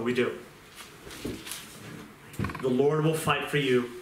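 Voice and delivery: ordinary delivery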